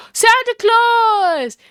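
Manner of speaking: gasp, then a high, loud, drawn-out cry; excited exclamation. Acted. Spotting Santa at the scene, excited exclamation. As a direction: call out in thrilled astonishment.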